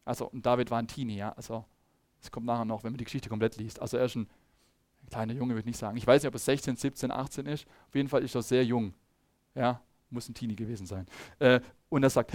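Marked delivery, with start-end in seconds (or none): none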